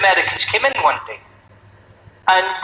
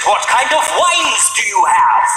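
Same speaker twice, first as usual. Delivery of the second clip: posh wine order; 5 semitones higher, slower than usual. Retold or imitated. Posh order in rough bar.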